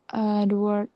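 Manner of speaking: ordinary delivery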